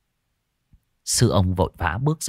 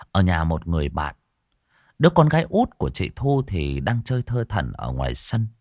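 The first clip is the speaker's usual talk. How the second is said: plain reading voice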